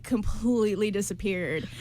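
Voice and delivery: normal talking voice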